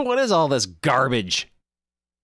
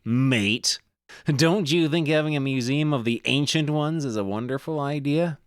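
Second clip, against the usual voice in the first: lightly hammed Australian accent; Australian adventurer voice. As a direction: play the greasy Aussie digger, mate. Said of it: "I can't keep doing that voice".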